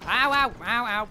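loud, high string of ows; repeated yelps of ow. Real, not acted. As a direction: yelp ow over and over. Being hit in the game.

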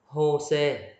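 Jose with a Spanish h sound, "ho-se"; Spanish pronunciation. Shown as a demonstration. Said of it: "đọc là hờ ha".

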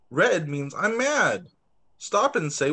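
plain reading voice

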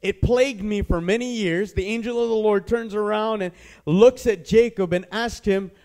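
normal talking voice

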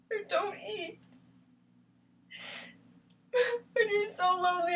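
high, crying lament; tearful despair. Acted. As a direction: let it sink into crying. Acted breakdown about loneliness.

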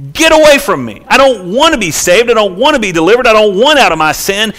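high, loud acted rejection; defiant refusal. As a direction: shove the rescuer away with your voice. Voices sinners refusing to be saved.

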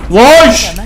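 loud, high startled yelp; startled curse. Real, not acted. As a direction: jump out of your skin. Startled by jump scare.

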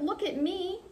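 high, mild show-off call; excited read-aloud shout; storybook shout. A narrator's reading. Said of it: "He twirled and shouted".

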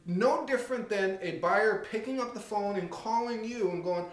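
ordinary talk, nothing marked

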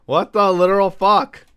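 high, loud, emphatic swearing; exasperated outburst. Real, not acted. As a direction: blow up at the game. Frustrated with repeated spawn.

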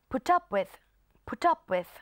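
"put up with" run together as "pu-tup with"; put up with linked. Shown as a demonstration. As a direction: carry the T of "put" onto "up". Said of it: "ลากเสียง T มาหา O ด้วย". (อ่าน "put up with" เชื่อมเสียง T กับ up)

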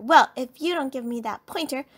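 mildly shouted comeback; angry retort. A narrator's reading. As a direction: threaten right back. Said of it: "shouted Sister".

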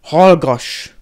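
loud, explosive one-word order; enraged yell. A narrator's reading. Piton beside himself, silencing Harry, enraged yell. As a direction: shout them down, beside yourself. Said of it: "Ordította magából kikelve Piton".

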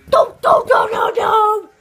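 mock frantic protest; shouting no. Toy refuses the doctor, loud frantic shouting.

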